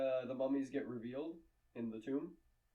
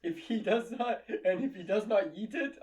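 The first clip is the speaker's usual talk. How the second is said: laughing; 7 semitones higher, 3 dB louder than usual. Real, not acted. Laughing while explaining.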